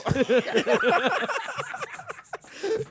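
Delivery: squealing laugh, high and loud; high laughing fit. Real, not acted. Laughing through his own story.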